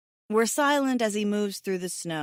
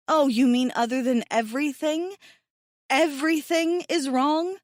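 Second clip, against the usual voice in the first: rising higher in disbelief; sarcastic exasperation; 6 semitones higher, 4 dB louder than usual. Acted. Mahdi answers Hassan with sarcastic disbelief, pitched high. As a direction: throw the question back, incredulous.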